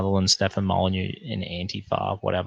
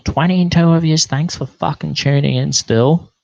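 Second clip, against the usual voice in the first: higher and louder, hyped welcome to the chat; loud hyped shout-out to the stream; excited greeting; 7 semitones higher, 7 dB louder than usual. Real, not acted. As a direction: pump up the viewers as you thank them. Greeting the live chat viewers.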